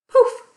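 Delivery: loud burst on poof; sudden loud magic poof. Acted. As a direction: pop it out like magic. Magic transformation in children's story.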